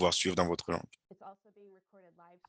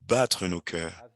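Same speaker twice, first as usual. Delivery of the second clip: slow, gentle prayer guide with long pauses; guided prayer; slower than usual. Acted. Brazilian pastor guiding webinar viewers into silent prayer, slow and gentle. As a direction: ease listeners into stillness before God.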